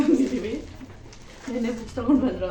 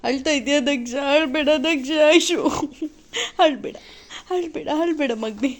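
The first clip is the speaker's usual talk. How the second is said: higher, louder coaxing to stop the tears; 7 semitones higher, 6 dB louder than usual. Real, not acted. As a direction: coo the little one out of crying, with a light laugh. Mother soothes crying child.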